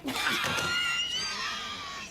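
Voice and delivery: long, very high-pitched squeal. Acted. Man overdoing his disguised high voice, long squeal. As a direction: hold a piercingly high note.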